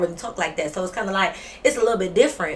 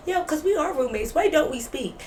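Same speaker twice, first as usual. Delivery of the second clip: higher-pitched mocking imitation; mock concern; 7 semitones higher than usual. Mocking a roommate who suddenly cares about speaking, mock concern. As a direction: mimic the roommate's put-on concern. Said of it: "you try to act like".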